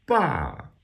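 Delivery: ba starting high and falling sharply; fourth tone. Shown as a demonstration. Teacher giving example of falling tone, falling ba. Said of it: "which is a falling tone".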